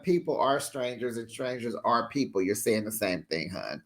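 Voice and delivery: low soft dry correction; soft sarcastic aside. Real, not acted. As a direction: say it under your breath, sarcastic.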